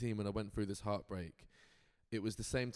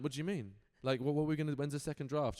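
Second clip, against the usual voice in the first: retold baffled questioning, pitched up; puzzled; 5 semitones higher, 3 dB louder, faster than usual. Writer questioning agent about a second draft, puzzled. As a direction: question it in mild mock bewilderment.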